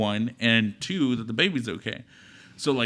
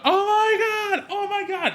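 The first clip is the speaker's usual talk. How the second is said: very high, slow squeal of disbelief; stunned amazement; slower than usual. Retold or imitated. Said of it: "And you're like".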